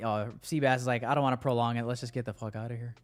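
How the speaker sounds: ordinary talk, nothing marked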